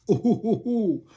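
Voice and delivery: sarcastic high ooh; mock awe.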